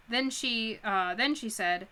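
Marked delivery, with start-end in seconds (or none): none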